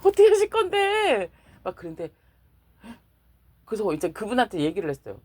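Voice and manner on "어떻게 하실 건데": high, louder whine at God; nagging God with a loud high whine; exasperated pleading. Retold or imitated. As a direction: demand it in a pleading shout. Pestering God in prayer.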